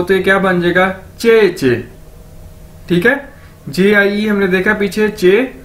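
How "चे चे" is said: "चे चे" as two deliberate syllables; careful model reading of "चे चे"; demonstrated pronunciation. Shown as a demonstration. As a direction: say "चे चे" like a teacher modelling a word. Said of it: "दो बार पढ़ें इसको".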